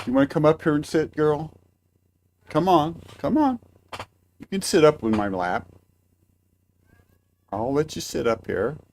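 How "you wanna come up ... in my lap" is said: higher, quicker pet-coaxing voice; calling the cat onto the lap, high and coaxing. Real, not acted. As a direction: coax the cat up with a rising voice.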